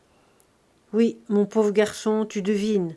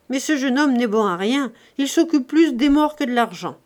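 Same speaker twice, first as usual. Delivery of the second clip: scornful; 3 semitones higher, 5 dB louder than usual. Acted. Grandet scornful of grieving nephew.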